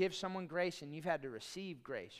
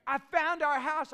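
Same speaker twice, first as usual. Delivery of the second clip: high, loud, slow thrilled announcement; excited wife; 9 semitones higher, 10 dB louder, slower than usual. Retold or imitated. Wife calling her husband to announce the house, excited. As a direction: burst with the big news. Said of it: "exuberant and animated".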